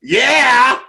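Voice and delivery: high, loud cheering yell; excited cheer. Real, not acted. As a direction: cheer the roll at full voice. Player cheers the dice roll.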